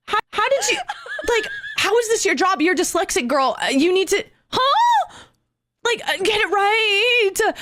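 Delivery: high, hammed-up exasperation; exasperated disbelief. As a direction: sputter in mock disbelief, laughing through it. Acted exasperation at dispatcher's mistakes.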